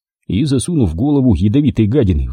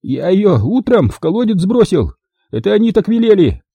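high-pitched, frightened blurting; panicked confession; 9 semitones higher than usual. A narrator's reading. Captive confesses to the well under pressure, panicked.

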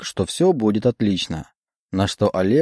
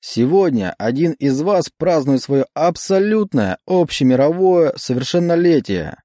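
solemn emcee; 4 semitones higher than usual. Acted. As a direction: declaim it like a showman host. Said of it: "торжественно декламировал он".

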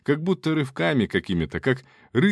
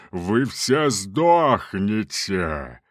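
demonic roar; 2 semitones lower, much slower than usual. Acted. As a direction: drag it out like a demon. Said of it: "заревел демон".

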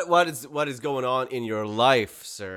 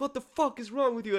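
high-pitched mock scolding; imitated yelling parents; 11 semitones higher, 4 dB quieter than usual. Parents cussing at the blamed kid, high mock yell. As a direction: imitate an irate parent without really shouting. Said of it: "and they yell at him".

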